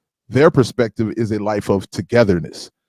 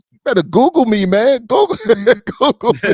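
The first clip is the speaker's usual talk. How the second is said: hyped catchphrase; 9 semitones higher, 3 dB louder than usual. Retold or imitated. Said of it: "As [name] and a lot of them other ones say".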